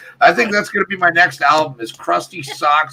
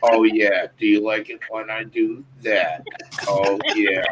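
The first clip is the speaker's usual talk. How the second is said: sexy robot voice; 9 semitones lower, 5 dB quieter than usual. Pretend. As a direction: purr it like a flirting robot. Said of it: "you gotta love that sexy robot voice".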